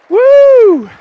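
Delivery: high loud cheering whoop; excited woo. Real, not acted. Host whooping to cheer the travellers. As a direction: whoop like a fan at a game.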